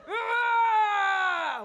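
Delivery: long loud comic scream; rage scream. Acted. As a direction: let out a drawn-out mock-furious "ah". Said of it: "Talking about rage!".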